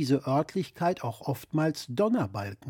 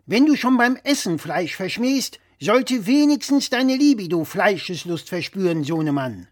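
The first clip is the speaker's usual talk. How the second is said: louder, higher know-it-all dad voice; blustering father; 5 semitones higher, 6 dB louder than usual. Acted. Father lecturing his son over the phone, louder and higher. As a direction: play the pompous father correcting his son.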